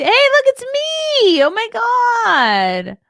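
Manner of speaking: thrilled high-pitched exclamation; excited squeal. Real, not acted. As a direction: squeal with surprise and delight. Sees herself in a chat clip.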